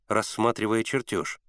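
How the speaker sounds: ordinary delivery, nothing marked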